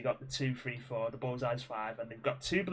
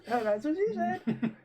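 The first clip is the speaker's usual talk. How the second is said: high laughing echo of the joke; 11 semitones higher than usual. Real, not acted. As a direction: say it while laughing.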